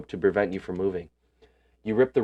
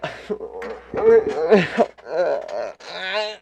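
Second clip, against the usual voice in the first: loud, high shocked laugh-cry; shouting laugh; 10 semitones higher, 3 dB louder than usual. Real, not acted. Gamer reacts to the gruesome dream with a loud laugh-shout. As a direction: burst out laughing and yelling at once.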